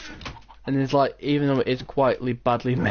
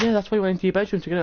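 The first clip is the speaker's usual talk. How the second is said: fast, higher mock mum; mum explaining; 7 semitones higher, much faster than usual. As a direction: rush it out like a know-it-all mum. Mum voiced in story.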